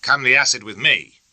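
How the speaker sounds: put-on a fair stab at Bennett's Dominion accent. A narrator's reading. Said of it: "with a fair approximation of Bennett's accent".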